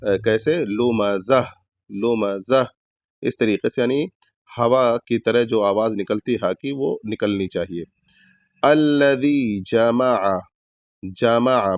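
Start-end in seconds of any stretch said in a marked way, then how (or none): 0.6-2.6 لمزہ ending with a breathy h sound; audible final ha. Shown as a demonstration. Teacher demonstrating a pause on لمزہ with audible h. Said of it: "چھوٹی ہا کی آواز ظاہر ہونی چاہیے". 8.6-11.8 الذی جمع chanted slowly with zabar on meem; slow recited الذی جمع; slow Quranic recitation. Shown as a demonstration. Teacher reciting the word to students, slow and drawn. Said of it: "میم پر زبر ہے تو زبر ہی پڑھنا ہے".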